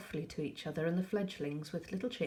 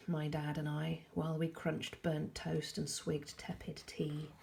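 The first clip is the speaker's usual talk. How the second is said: plain reading voice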